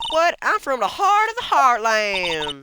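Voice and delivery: high, slow southern boast; stretched-out hammed-up southern twang; bad southern accent. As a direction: declare home proudly in a southern accent. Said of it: "southern accent for the life of me".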